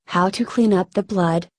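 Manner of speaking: ordinary delivery, nothing marked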